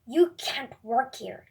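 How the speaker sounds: stern, loud put-down; very angry. A narrator's reading. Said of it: "Gordon is very angry".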